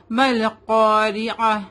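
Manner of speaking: مل قاریعہ slow, م joined to ل, heavy ق; drawn out: مل قاریعہ with merged mal and heavy qaf; tajweed model. Shown as a demonstration. Teacher shows the student how to join the letters, slow demo. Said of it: "سے ہا کی آواز آئے گی".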